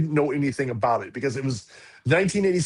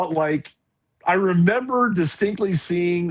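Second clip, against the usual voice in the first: ordinary talk, nothing marked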